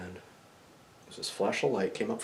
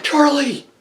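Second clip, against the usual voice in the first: high, loud cry of recognition; excited shout; 16 dB louder than usual. Retold or imitated. Dreamer recognizes his ghost friend, excited shout. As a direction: shout the name in sudden recognition.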